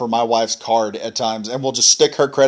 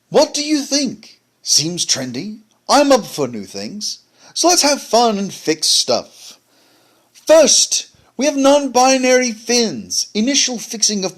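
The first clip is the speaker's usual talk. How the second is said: fancy fartsy voice; 9 semitones higher than usual. Acted. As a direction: read it like a mock-refined snob, pitched way up and swooping down. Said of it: "my fancy fartsy voice".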